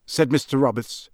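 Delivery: ordinary delivery, nothing marked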